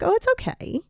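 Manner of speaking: high, easy forgiving tone; forgiving reassurance. Retold or imitated. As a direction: brush the mistake off kindly. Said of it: "you're gonna be like".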